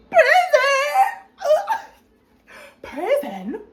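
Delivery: very high, loud shriek with laughing; shocked disbelief. Real, not acted. Reacting to the lawyer's news of prison, shocked shriek. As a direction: scream the word in horror and laugh.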